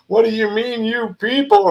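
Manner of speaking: high, indignant mock outrage; sharp high-pitched offended challenge; offended retort. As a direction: play it up like you're insulted. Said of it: "I'm getting all offended by it".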